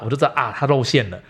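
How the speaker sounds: normal talking voice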